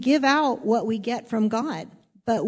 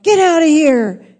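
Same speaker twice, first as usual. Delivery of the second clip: loud, higher-pitched mock yell; joke bartender's yell; 6 semitones higher, 12 dB louder than usual. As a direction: yell it out like a bartender throwing customers out. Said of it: "the bartender yelled".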